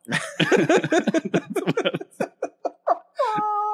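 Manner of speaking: high, shrieking burst of laughter; helpless high cackle after the guess; hysterical laughter. Real, not acted. Cracking up at his own wrong guess, shrieking laughter.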